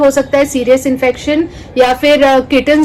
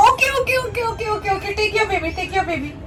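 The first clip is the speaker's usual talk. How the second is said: high, soft hurried reassurance to a cat; pacify the mother cat in a small, high voice; high-pitched, hushed placating; anxious soothing; 7 semitones higher, 9 dB quieter than usual. Real, not acted. Handing a crying kitten back to mother.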